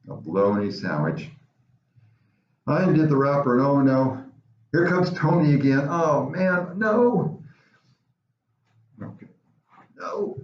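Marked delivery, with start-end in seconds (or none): none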